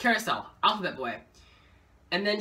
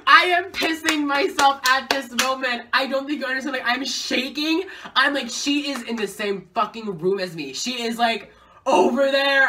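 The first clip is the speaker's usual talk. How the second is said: starstruck excitement; 7 semitones higher, 6 dB louder than usual. Retold or imitated. Recalling being starstruck.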